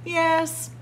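plain reading voice

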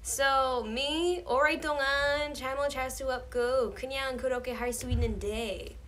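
deliberately bad American accent in Korean. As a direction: exaggerate an American learner's Korean pronunciation. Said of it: "which is, like, American accent".

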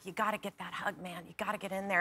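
low, soft urging under the breath; hushed self pep talk. Retold or imitated. Actress urging herself to get that hug, hushed pep talk. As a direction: psych yourself up quietly.

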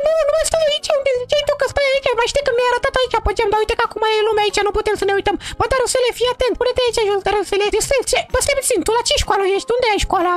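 put-on high falsetto girl voice; high put-on girl voice scolding her old friend. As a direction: play the offended girl in a high voice. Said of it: "trebuie să fac vocea".

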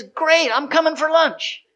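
high, bright laughing announcement; cheery self-invite. Retold or imitated. Lady invites herself to lunch.

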